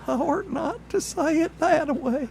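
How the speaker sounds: put-on high old hillbilly scolding; old country feller voice. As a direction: say it again as a fussy old country man. Redoes line in old-timer voice.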